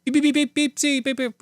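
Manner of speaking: very high beeping like a little robot; high electronic droid beeps. Pretend. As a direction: chirp out beeps like R2-D2. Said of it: "R two D two".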